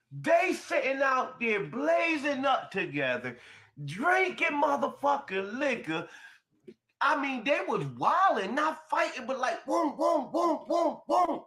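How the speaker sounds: high-pitched, amazed storytelling at full tilt; excited retelling. Retold or imitated. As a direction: build up to high, punched booms. Excited retelling of the wild gathering.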